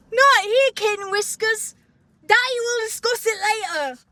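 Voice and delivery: high-pitched, hammed-up character accent; Prince Charming. Host mocking the fruitiest line, exaggerated prince accent. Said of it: "should I try to do the accent?".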